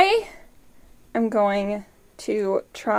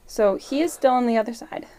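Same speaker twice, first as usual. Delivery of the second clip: normal talking voice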